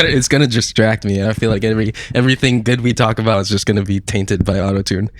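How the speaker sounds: ordinary delivery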